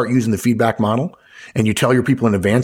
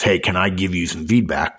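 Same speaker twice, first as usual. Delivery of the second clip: lower, ominous polite question; foreboding feedback request; 3 semitones lower than usual. Shown as a demonstration. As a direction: ask as if bad news is coming. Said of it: "indication that it's gonna be negative".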